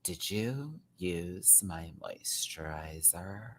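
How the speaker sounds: slow, whispered mock accusation; snooty cartoon teen voice. Vlogger quoting a fashion-club girl, slow mock whisper. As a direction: breathe the question out slowly, prissy and hushed. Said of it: "I can't even get the voice out".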